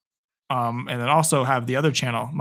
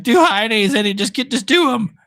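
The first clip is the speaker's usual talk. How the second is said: imitating himself, higher and louder with a strained voice; worn-out coach voice; 8 semitones higher, 4 dB louder than usual. Streamer imitating himself coaching kids tomorrow, mock coach shout. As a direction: bark the drill like a hoarse gym coach. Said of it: "Why do you sound like that?".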